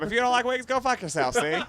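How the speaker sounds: a wiseguy tough talking, see; old-timey voice. Pretend. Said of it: "The old-timey voice".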